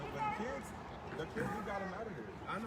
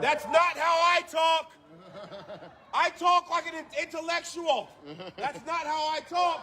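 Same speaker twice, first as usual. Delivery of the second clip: slow, louder put-on voice, laughing at first; mock intellectual; 6 semitones higher, 14 dB louder, slower than usual. Protester parodying how he is made to sound, slow and pompous. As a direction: stretch every word like a pompous intellectual. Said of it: "that's making a mockery of it".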